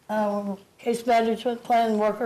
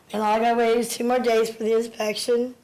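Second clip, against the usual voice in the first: usual speaking voice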